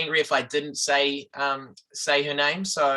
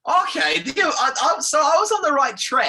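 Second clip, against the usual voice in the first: excited; 9 semitones higher, 6 dB louder than usual. Real, not acted. Guest laughs, mimics high.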